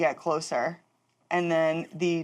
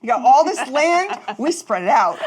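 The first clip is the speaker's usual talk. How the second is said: teasing; 7 semitones higher, 7 dB louder than usual. Real, not acted. Teasing rebuttal, louder.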